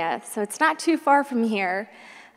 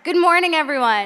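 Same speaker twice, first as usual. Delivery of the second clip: bright loud good morning, pitched up; energetic greeting; 5 semitones higher, 7 dB louder than usual. Shown as a demonstration. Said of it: "I like that better".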